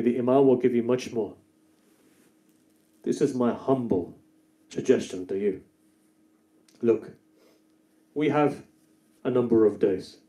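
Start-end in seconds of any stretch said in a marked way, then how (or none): none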